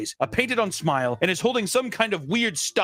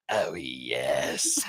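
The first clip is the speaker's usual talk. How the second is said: much lower, monotone creepy answer; deep doll voice; 14 semitones lower, 4 dB quieter than usual. Retold or imitated. The doll answering the boy, very low eerie voice. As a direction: drop it deep like a possessed doll. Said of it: "doll would respond in a ... deeper voice".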